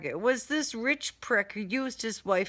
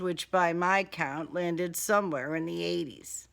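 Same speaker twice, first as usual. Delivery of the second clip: plain reading voice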